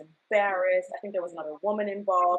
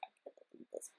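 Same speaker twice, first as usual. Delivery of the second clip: hushed broken whisper, much softer; tearful whisper; 18 dB quieter, faster than usual. Retold or imitated. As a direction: whisper it as if crying into a pillow. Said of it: "I was crying and I was so sad".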